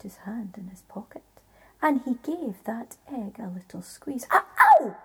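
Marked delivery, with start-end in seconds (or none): 4.3-5.0 sudden high loud yelp of pain; a giant yelping as his heart is squeezed; giant's cry of pain. A narrator's reading. Giant hurt by the squeezed egg, high loud yelp. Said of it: "said the giant".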